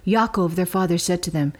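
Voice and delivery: ordinary delivery, nothing marked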